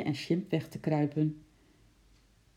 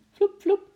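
much higher, louder "Vloep, vloep"; leaping sound; 7 dB louder than usual. A narrator's reading. Narrator sounds out Sjimp leaping down the corridor, high and loud. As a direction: whoosh it out like quick leaps.

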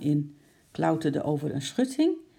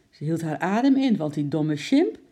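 plain reading voice

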